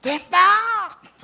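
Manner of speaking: high, loud shrill call of a name; shrill wife's call. Retold or imitated. Pastor mimics his wife calling him, shrill and loud. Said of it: "yung tinis ng boses ni".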